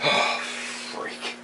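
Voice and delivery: whispered sigh of frustration; sighed curse. Real, not acted. Fish keeper sighing a curse over lost fry. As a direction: let it out on a sigh.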